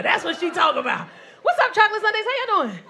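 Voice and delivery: normal talking voice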